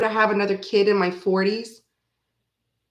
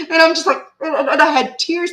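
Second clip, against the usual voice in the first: laughing; 7 semitones higher, 5 dB louder than usual. Real, not acted. Still laughing at the store.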